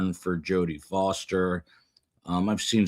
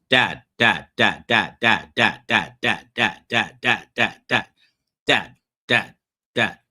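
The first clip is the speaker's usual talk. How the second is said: mock kids chanting dad, louder; nagging kids; 2 semitones higher, 5 dB louder than usual. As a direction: imitate children calling nonstop for attention. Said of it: "I hear this".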